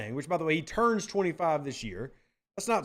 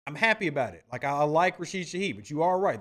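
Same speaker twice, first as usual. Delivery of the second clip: ordinary talk, nothing marked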